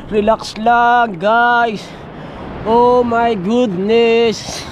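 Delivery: slow, low, stretched-out words; low and lazily drawn out; laid-back drawl. Real, not acted. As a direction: take it easy, dragging each word out. Floating on water, taking it easy.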